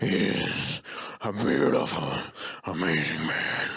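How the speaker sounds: hammed-up drummer impression, halting and grand. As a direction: intone it in pieces, reverently. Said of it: "do the really good [name] impression".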